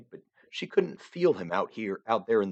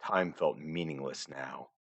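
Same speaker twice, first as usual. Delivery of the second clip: ordinary delivery, nothing marked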